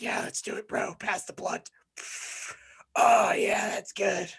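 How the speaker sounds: softly voiced party bro; half-drunk stoner. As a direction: act mildly wasted, passing a joint. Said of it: "they're, like, half drunk".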